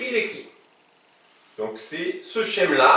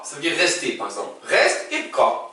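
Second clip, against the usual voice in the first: ordinary delivery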